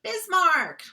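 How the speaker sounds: high, loud North Dakota "Bismarck"; North Dakota accent. Retold or imitated. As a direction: chirp the town name in a North Dakota accent. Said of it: "She's like".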